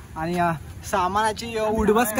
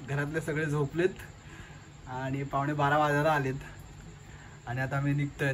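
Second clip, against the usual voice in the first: hushed so the house sleeps; quiet at night; 6 semitones lower, 7 dB quieter than usual. Real, not acted. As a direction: keep it low, family asleep. Everyone asleep, leaving at night.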